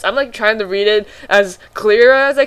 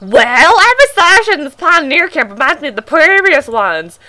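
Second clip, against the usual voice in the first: high put-on voice; calm pioneer in a high voice; lightly crow-voiced pioneer; 6 semitones higher, 4 dB louder than usual. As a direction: nonchalant stranger. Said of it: "he said calmly".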